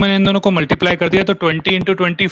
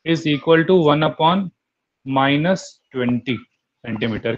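slow dictation; much slower than usual. Shown as a demonstration. Dictating formula slowly to class.

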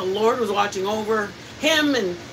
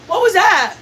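high, louder alarmed "What was that?"; startled question; 8 semitones higher, 6 dB louder than usual. Retold or imitated. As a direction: sound suddenly alarmed. Family startled by sound.

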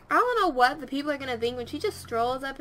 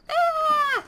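high, loud cry; sharp high-pitched yelp; startled yelp; 14 semitones higher, 7 dB louder than usual. Real, not acted. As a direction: let out a sudden high "ah". Startled while playing the game.

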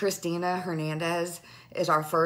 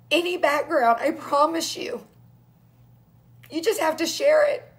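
tearful plea; 7 semitones higher, 3 dB louder than usual. Real, not acted. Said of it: "I can't stop crying".

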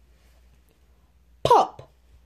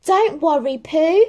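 calling out; 9 semitones higher, 6 dB louder than usual. Acted. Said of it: "Christopher Robin called".

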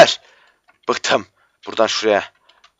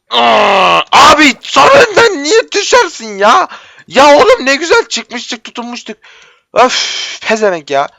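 high, loud wail of frustration; anguished yell at the game; frustrated outcry; 11 semitones higher, 5 dB louder than usual. Real, not acted. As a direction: cry out as you fall. Falls from the parkour ledge.

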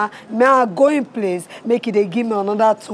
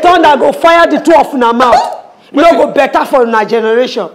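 loud, raised-pitch curse; angry cursing; 3 semitones higher, 9 dB louder than usual. Acted. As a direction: lash out at the mockers. Curses the two who mock her.

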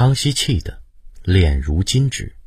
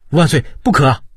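higher and louder, a desperate cry to the emperor; 8 semitones higher, 5 dB louder, faster than usual. A narrator's reading. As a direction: cry out to stop him. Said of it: "张廷玉大叫一声".

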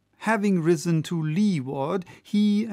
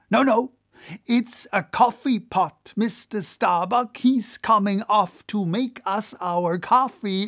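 higher, joking sailor voice with a hint of mockery; lightly voiced second mate poking fun; jovial Stubb; 5 semitones higher than usual. A narrator's reading. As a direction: tease the mate, laughing at the German. Stubb's joking speech in the story.

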